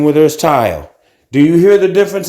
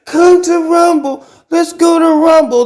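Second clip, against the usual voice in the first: mocking hype pitch; 13 semitones higher than usual. Mocking everybody urging him onto the platform, in a high hyped voice. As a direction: parrot the crowd's eager high-pitched pitch. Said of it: "like everybody saying".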